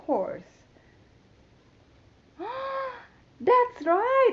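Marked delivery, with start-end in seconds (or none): 2.4-2.9 mock gasp. Surprise at blue horse.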